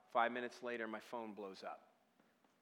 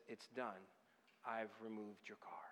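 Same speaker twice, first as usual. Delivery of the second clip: low, near-whispered finality; soft, low voice of God; hushed divine word; 5 semitones lower, 11 dB quieter than usual. Retold or imitated. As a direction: drop to a whisper, with quiet authority.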